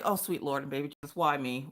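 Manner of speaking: low, soft mock prayer; muttered plea. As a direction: mutter low and quick to heaven in exasperation. Retold prayer over her dog's antics.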